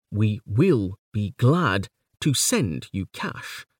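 slow "We will be glad to send you cash", weight on the meaning words; measured beat, stressing "glad", "send", "cash"; selected words stressed. Shown as a demonstration. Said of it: "some will be stressed more prominently".

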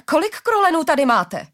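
high, loud cry of dismay; acted alarmed outcry; horrified cry. A narrator's reading. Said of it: "Vykřikl zděšeně Harari".

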